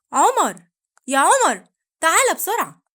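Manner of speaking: loud urgent call. Acted. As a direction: shout for the child to come. Mother calls out urgently.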